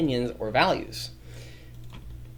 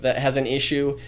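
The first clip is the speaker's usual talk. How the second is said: ordinary talk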